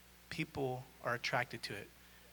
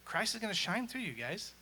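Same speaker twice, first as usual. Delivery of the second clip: ordinary talk, nothing marked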